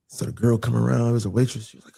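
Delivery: ordinary talk, nothing marked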